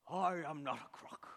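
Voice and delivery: put-on gruff presidential denial; Nixon impression. Pastor acts out Nixon for the congregation, mock denial. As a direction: insist it like Nixon at the press conference. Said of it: "do my best impression of a U S president".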